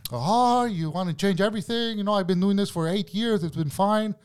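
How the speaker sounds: high, put-on whine of protest; mock offended coworker. Coworker confronted about changing her old way, mock offended. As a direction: imitate an affronted coworker, pitched well up. Voicing coworker's side of quarrel.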